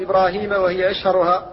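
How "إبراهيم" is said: "إبراهيم" as Ibraaheema, long ee after h; alif changed to ya. Shown as a demonstration. Teacher listing variants, "إبراهيم" pronounced with ya. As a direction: say "إبراهيم" with a long ee in the last syllable. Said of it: "أو يبدل ياء فيقال".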